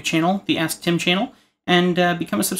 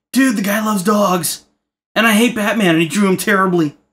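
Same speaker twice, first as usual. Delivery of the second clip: mock viewer rant; 3 semitones higher, 5 dB louder than usual.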